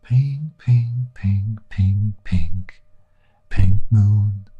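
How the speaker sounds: lightly hushed, drawn-out singer voice. Retold or imitated. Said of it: "That's my [name] impression".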